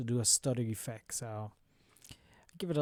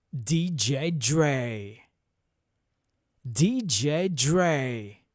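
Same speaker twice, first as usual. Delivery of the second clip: slow, loud radio DJ drop; 9 dB louder, much slower than usual. Shown as a demonstration. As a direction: hype DJ announcer voice. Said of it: "So I'm gonna voice a drop".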